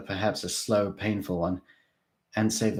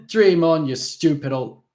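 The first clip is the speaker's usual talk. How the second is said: higher, louder jeering taunt; scornful jibe, cut off; mocking taunt; 8 semitones higher, 9 dB louder than usual. A narrator's reading. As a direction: sneer it at him.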